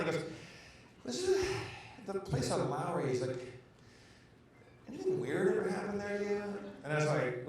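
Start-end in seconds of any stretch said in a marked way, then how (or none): 1.0-3.1 small, halting, tearful question; imitating someone about to cry. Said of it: "he's about to cry". 4.9-6.4 high, timid, tearful question; imitating someone about to cry. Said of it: "he's about to cry".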